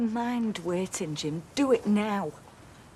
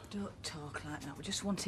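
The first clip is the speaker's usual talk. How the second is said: hushed plea; 9 semitones lower, 12 dB quieter than usual. Acted. Worried mother pleads for son.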